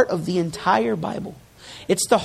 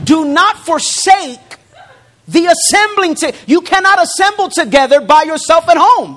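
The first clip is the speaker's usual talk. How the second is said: loud, rising preacher's call; preaching at full force; 10 semitones higher, 8 dB louder than usual. Acted. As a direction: drive the point home to the congregation.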